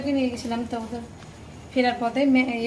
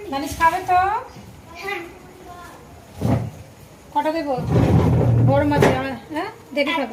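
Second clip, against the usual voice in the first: raised, higher caring call; 5 semitones higher, 4 dB louder than usual. Real, not acted. Serving hot momo to child.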